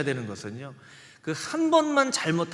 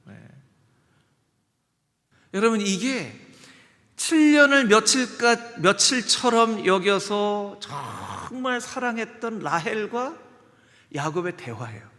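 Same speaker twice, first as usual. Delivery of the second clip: ordinary talk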